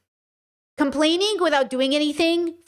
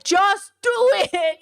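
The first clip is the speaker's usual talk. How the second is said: slow, higher, punchy slogan; pep shout; 7 semitones higher, 4 dB louder, much slower than usual. Retold or imitated. Streamer telling complainers to just do it, slow and punchy. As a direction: drive it home like a coach. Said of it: "I'm feeling very, like".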